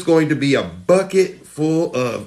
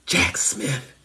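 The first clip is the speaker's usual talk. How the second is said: hushed, unvoiced whisper of a name; spooky whisper; 5 semitones lower, 6 dB quieter than usual. Shown as a demonstration. Host showing Trump supporters a bathroom trick, whispering. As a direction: whisper it like a summoning chant. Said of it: "and you whisper".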